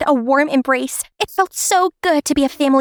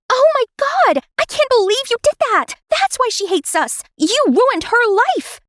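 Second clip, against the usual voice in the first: outraged outburst; 11 semitones higher than usual. Acted. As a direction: explode at the mother's confession. Daughter learns mother's secret.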